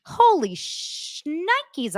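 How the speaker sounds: ordinary talk, nothing marked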